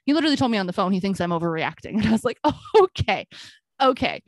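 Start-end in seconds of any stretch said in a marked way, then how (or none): 2.4-4.2 sarcastic okay. Retold or imitated. Sarcastic reply to landlord.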